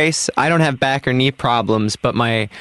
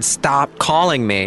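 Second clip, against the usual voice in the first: slow, firm; much slower than usual. Retold or imitated. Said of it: "'cause I said".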